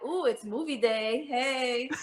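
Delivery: a lightly acted schoolkid's happy "ooh"; playful mock delight. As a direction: act out mild, carefree excitement. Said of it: "I thought I was just like".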